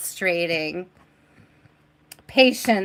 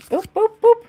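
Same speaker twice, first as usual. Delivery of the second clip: playful boops; 12 semitones higher, 4 dB louder, faster than usual. Real, not acted. Playful sound effect with cards.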